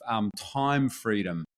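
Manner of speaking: ordinary talk, nothing marked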